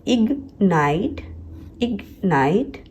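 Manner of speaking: Ignite slowly as इग, pause, night; two slow beats in ignite; split with G sounded. Shown as a demonstration. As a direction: break ignite after the G. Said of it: "जी का sound आता है".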